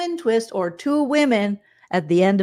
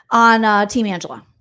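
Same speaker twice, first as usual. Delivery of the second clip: ordinary talk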